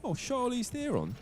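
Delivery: high, soft, drawn-out movie impression; quoting a movie line. Caster quoting a movie line to his partner, high mock voice. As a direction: stretch it out slowly in a high, quiet put-on voice. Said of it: "it's from a movie where they're like".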